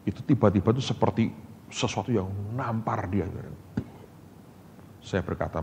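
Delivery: ordinary delivery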